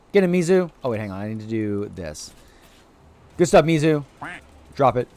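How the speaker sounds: ordinary talk, nothing marked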